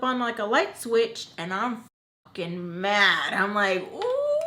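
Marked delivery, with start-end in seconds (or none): 3.9-4.4 much higher furious squeal; a sudden angry squeal when the anger flips on; livid. Retold or imitated. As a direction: let the rage burst out in a sound. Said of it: "I get livid".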